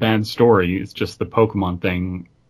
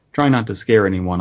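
normal talking voice

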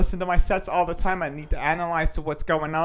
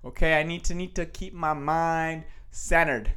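normal talking voice

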